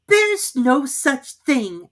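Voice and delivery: high, slow, indignant protest; angry Alice. A narrator's reading. Alice objecting to the Dormouse, angrily. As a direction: drag it out in outrage. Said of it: "was beginning very angrily".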